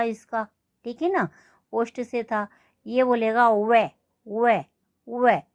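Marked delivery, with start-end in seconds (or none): 2.9-5.4 वे repeated with teeth on lip, a little louder; sounding out वे. Shown as a demonstration. Teacher demonstrating वे sound to students. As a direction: press teeth to lip for each वे. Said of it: "यानी गले, होठ भी".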